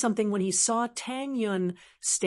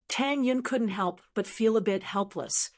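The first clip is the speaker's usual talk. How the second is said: usual speaking voice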